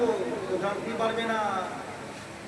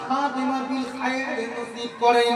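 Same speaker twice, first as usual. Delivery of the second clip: ordinary talk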